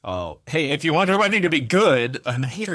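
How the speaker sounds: high, loud, put-on pushy helper voice; a hammed-up nosy relative offering help; mock pushy helper. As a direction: play the overeager guest pushing help. Mocking the pushy wedding offers.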